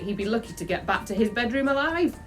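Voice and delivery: ordinary delivery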